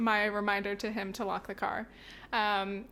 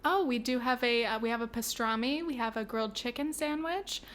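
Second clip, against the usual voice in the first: cashier voice. Pretend. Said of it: "I'll fucking do it".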